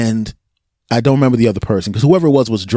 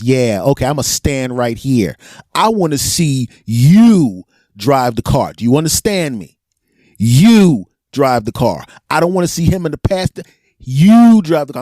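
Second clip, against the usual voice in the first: stern cop orders; 2 semitones higher than usual. Retold or imitated. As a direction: spell out each order slowly like a stern cop. Cop orders driver, slow firm.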